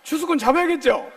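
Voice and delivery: 추수꾼 잡아야겠죠 called out loud with a falling push on 잡아야겠죠. Shown as a demonstration. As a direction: rouse the crowd, loud and firm on 잡아야겠죠. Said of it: "대답이 시원찮아요".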